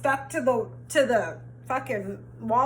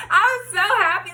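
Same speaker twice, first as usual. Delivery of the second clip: laughing happily; 9 semitones higher, 3 dB louder than usual. Real, not acted. Laughing at the memory.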